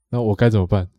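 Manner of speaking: normal talking voice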